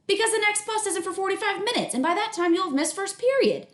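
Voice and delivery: a busy mom calling up; mom's voice. Acted.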